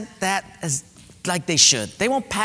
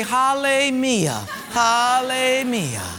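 slow sarcastic hallelujah; drawn-out mock worship of yourself; sarcastic self-praise; 5 semitones higher, slower than usual. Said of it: "bow down and say".

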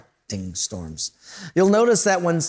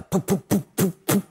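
engine sputter; 3 semitones higher than usual. Retold or imitated. Imitating sputtering plane engine.

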